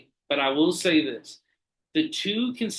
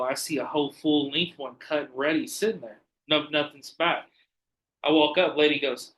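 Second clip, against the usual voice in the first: ordinary delivery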